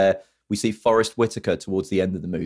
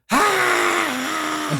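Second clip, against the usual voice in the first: high hammed-up scream; mock scream. Podcaster mimicking Forrest Whitaker's scream through flames, high yell. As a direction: squeal it like jumping through flames. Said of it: "He's like".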